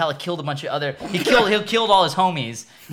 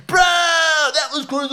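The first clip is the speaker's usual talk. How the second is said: high, slow, loud amazed shout; mock amazement; 11 semitones higher, 5 dB louder, slower than usual. Friend acting out the audience's shock, loud amazement. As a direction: cry out stunned at the trick.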